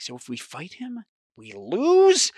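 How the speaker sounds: starts hushed, jumps high on "lose"; dawning disbelief. Real, not acted. Realizes the rule means defeat.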